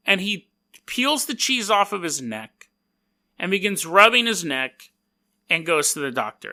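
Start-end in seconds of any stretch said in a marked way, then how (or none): none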